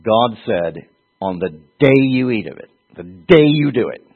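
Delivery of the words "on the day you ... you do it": DAY hit high and loud; emphatic God quote. Acted. God warning about the day you eat, emphatic. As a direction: punch "day" each time, rising in force. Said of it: "So it's like this".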